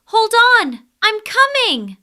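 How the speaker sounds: loud, high urgent call. Acted. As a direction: shout encouragement on the way. Mouse calling to trapped lion.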